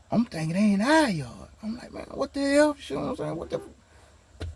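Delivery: high, thin alarmed voice trailing to a whisper; startled confusion. Retold or imitated. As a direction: woken up scared at night. Startled awake by police.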